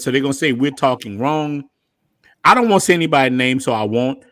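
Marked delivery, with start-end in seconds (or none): none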